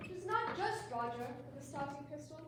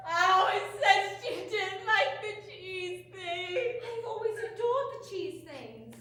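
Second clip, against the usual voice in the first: mock wailing; 3 semitones higher, 8 dB louder, slower than usual. Wailing about cheese things.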